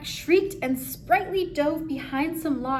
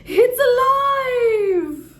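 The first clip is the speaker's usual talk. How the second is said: much higher, louder, slow, drawn-out cry; mad scientist shout; 11 semitones higher, 10 dB louder, much slower than usual. Acted. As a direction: shout it like Frankenstein at his creation.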